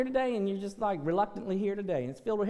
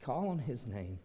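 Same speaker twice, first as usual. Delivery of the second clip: low, soft pastoral invitation; hushed altar-call plea; 10 semitones lower, 10 dB quieter than usual. Acted. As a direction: pull them in gently, almost confiding.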